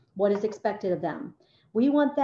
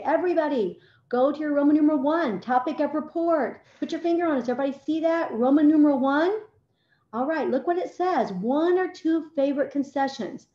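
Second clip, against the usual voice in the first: higher-pitched, projected classroom instructions; demonstrating her bright-and-clear teacher voice to pupils; teacher addressing class; 5 semitones higher, 4 dB louder than usual. Acted. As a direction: call the whole class to attention.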